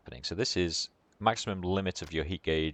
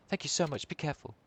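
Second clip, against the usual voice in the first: higher, lilting grateful voice; grateful mother; 10 semitones higher, faster than usual. Acted. Mother thanking Kiryu as he leaves, higher grateful voice. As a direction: thank him in a raised feminine pitch.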